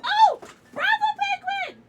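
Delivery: very high, delighted cheer; excited squeal. Real, not acted. Streamer cheering a viewer by name, excited squeal.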